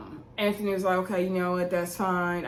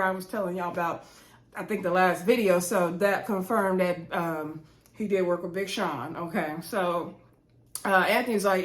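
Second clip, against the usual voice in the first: usual speaking voice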